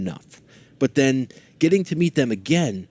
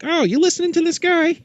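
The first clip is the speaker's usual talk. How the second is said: much higher, louder cartoon-turtle wisecrack; Raph voice; 4 dB louder than usual. Fan voicing Raph's sarcastic line at a friend, higher and louder. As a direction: toss off a cocky Raph one-liner. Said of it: "like Raph would do".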